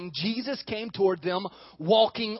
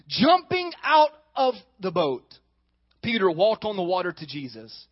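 ordinary delivery

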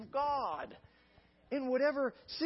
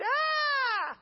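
high loud mock scream of fear; terrified scream; 6 dB louder than usual. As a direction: scream like frightened schoolgirls. Disciples cried out in fear.

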